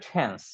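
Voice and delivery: "টেন্স" with a puffed, aspirated ঠ at the start. Shown as a demonstration. Teacher showing students the right pronunciation, "টেন্স" with aspirated ঠ. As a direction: say "টেন্স" as "ঠেন্স", with a breath after the T. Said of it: "যেটা right উচ্চারণ হচ্ছে".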